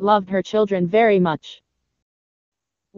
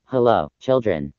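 much lower wolf voice faking a parent; low voice calling through the door; wolf greeting the goats in his deep voice; 10 semitones lower than usual. Acted. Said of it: "said in his deep voice".

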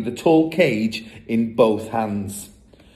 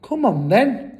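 high, rallying call; eager urging; 10 semitones higher, 4 dB louder than usual. A narrator's reading. Brother rallies the family, eager. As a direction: urge them along keenly.

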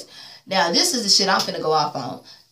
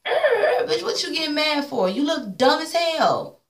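high, whiny mock voice; mocking Jay; 6 semitones higher than usual. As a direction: whine it like a sloppy drunk. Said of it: "You wanna talk about".

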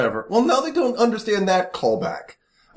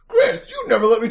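a touch higher, laughing half-sobbed whine; mock co-host voice; 6 semitones higher than usual. Doing his co-host's voice to tease her, laughing and whiny. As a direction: play your co-host with a teasing whine. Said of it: "I could do this podcast as you".

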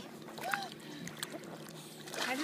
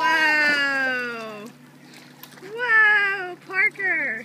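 surprised whoa; 3 semitones higher, 7 dB louder, much slower than usual. Real, not acted. Baby tipping in tube.

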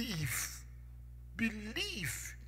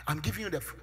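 ordinary talk, nothing marked

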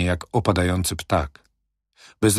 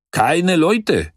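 a broad laugh in the voice, higher; laughing broadly; 10 semitones higher, 4 dB louder, slower than usual. A narrator's reading. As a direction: call it out with a wide grin. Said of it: "roześmiał się szeroko żołnierz".

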